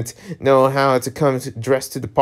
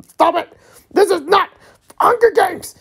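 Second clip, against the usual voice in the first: put-on panicked girl voice. Character pleads under attack.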